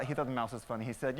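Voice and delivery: usual speaking voice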